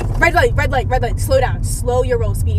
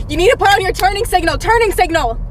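urgent nagging; 7 semitones higher, 4 dB louder than usual. Acted. Mom urging son driving.

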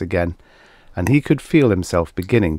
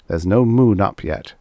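ordinary delivery, nothing marked

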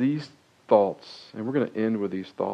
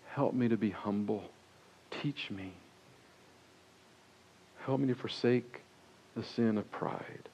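quiet prayer; 9 dB quieter than usual. Retold or imitated. Voicing a humble prayer.

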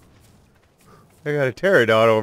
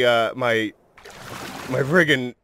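usual speaking voice

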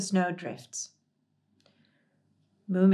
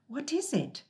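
anxious; 8 semitones higher, 5 dB quieter than usual. A narrator's reading. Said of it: "the Snork Maiden said anxiously".

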